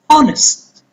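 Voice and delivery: Honest with HON high and long; Honest, HON-est, careful teaching demo; stressed first syllable. Shown as a demonstration. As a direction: punch the first syllable of Honest, let the end fall. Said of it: "going to pronounce louder and longer".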